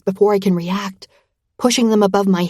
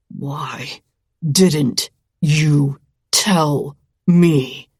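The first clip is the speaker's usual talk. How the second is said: lower, slow, through gritted teeth; 4 semitones lower, much slower than usual. A narrator's reading. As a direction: squeeze it out through clenched teeth. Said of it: "he says through gritted teeth".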